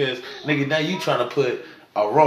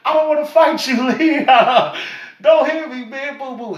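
high, giggling fake begging; laughing mock plea; 10 semitones higher than usual. Lying on the ground, pleading with Lee through laughter. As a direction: beg not to be hit while laughing. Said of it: "laid on the ground again".

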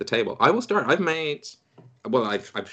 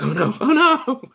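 high, loud mock choking panic; 13 semitones higher, 5 dB louder than usual. Host imitates cohost choking on pancakes, high mock panic. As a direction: yelp it like you're choking on pancakes. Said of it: "You're like".

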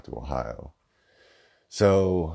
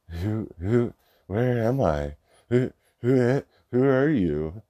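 mock dazed, higher pitch; fake confused; 6 semitones higher, slower than usual. Mocking girlfriend's fake amnesia, higher and dazed. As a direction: play dumb like a bad actress faking amnesia. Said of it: "she acted like she didn't know".

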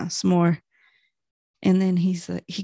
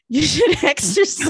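high, louder child imitation with a laugh; little nephew; 9 semitones higher, 5 dB louder than usual. Aunt quoting her nephew's advice, high kid voice with laughter. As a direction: mimic a small child, laughing through it. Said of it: "he was like".

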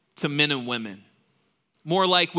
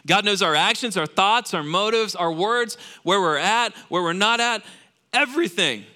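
preaching build; 6 semitones higher, 4 dB louder than usual. Real, not acted.

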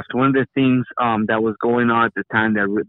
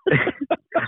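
high embarrassed giggle; sheepish laugh; 14 semitones higher, 4 dB quieter than usual. Real, not acted. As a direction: laugh it off, caught out. Caught sweeping during call.

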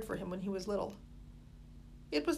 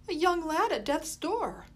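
higher, louder, slow dramatic exclamation; exclaiming; 8 semitones higher, 6 dB louder, slower than usual. A narrator's reading. Molly seeing the starving boy, loud dramatic exclamation. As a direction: cry out in pitying alarm. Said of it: "Molly exclaimed".

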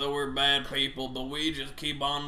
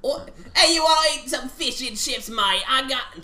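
put-on botched British accent, higher and louder; bad British accent; 8 semitones higher, 4 dB louder than usual. Host attempting a British accent, mocked by friends after. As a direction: try a British accent and fail badly. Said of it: "what the fuck was that?".

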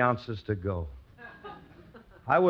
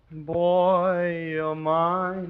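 creepy villain voice; 8 semitones higher, 4 dB louder, much slower than usual. Acted. Creepy possessive line, repeated.

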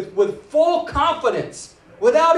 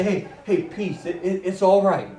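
reassuring God; 4 semitones lower, 4 dB quieter than usual. Acted. God calming a terrified man.

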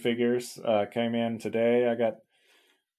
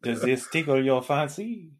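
hammed-up fake French accent; terrible French accent; 3 semitones higher than usual. [name] playing undercover artist to gallery visitors, terrible French accent. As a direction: keep up the bad French act. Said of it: "terrible French accent".

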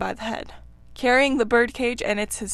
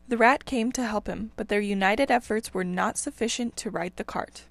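ordinary delivery, nothing marked

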